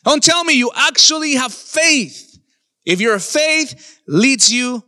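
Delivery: forceful sermon delivery with stretched key words; emphatic preaching. Real, not acted. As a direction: preach with rising conviction, pausing hard for weight. Preacher drives home the final point.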